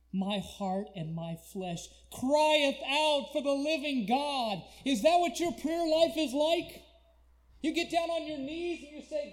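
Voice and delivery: loud, high-pitched sermon cry; full-force preaching. Acted. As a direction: thunder it out to the congregation.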